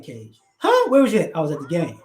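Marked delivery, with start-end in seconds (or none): 0.6-0.9 sharp high disbelieving huh; surprised huh. Acted. Friend reacting to news, high shocked huh.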